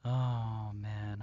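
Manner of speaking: ordinary talk, nothing marked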